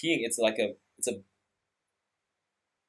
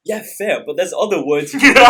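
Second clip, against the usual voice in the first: higher, louder, laughing through it; coach's reply dissolving into laughter; 5 semitones higher, 10 dB louder than usual. Retold or imitated. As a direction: barely hold in a laugh as the coach.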